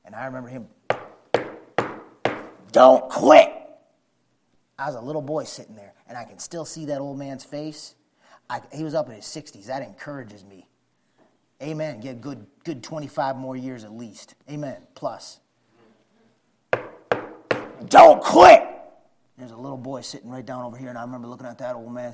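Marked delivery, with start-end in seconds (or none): 2.7-3.5 put-on loud growly old preacher; growly old man. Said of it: "He had an old growly voice". 17.9-18.6 put-on high, loud growling old man; growly old man. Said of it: "He had an old growly voice".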